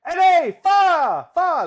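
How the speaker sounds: high, loud shrieks of alarm; frantic high yells for help; panicked screaming. Acted. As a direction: scream his name as the kitchen burns. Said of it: "Like that".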